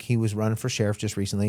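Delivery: usual speaking voice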